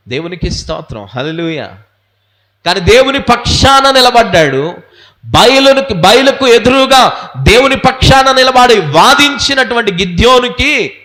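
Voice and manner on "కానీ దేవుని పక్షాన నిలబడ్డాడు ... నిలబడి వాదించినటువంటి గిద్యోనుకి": raised, forceful sermon voice; preacher building emphatic phrases; impassioned preaching. Real, not acted. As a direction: proclaim it to the whole congregation. Preaches on Gideon.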